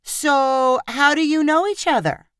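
high, knowing question through a smile; satisfied smile. A narrator's reading. Lyrica turning tables on friend, smugly satisfied. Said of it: "asked with a satisfied smile".

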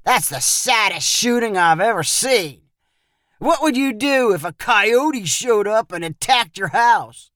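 scornful bully's taunt. A narrator's reading. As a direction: rub in the failure. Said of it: "Perry said tauntingly".

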